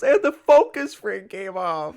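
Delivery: very high, loud mock wail; freaking out. Kid lamenting his broken camera, freaking out. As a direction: stretch it out in hammed-up kid hysterics. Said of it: "all the kids are freaking out".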